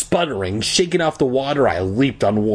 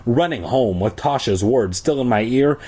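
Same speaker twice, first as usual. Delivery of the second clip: ordinary delivery, nothing marked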